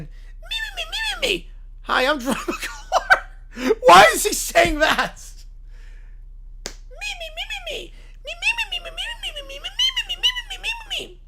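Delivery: very high squeaky meeping; Beaker voice. Acted. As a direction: squeak it out like a nervous Muppet. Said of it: "Muppet Beaker Huts bounces in".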